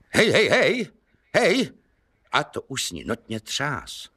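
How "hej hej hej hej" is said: high, loud frantic calling; zoufalé volání. Acted. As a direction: shake her awake with shouts. Kubát shakes the woman. (vysoké hlasité zoufalé volání)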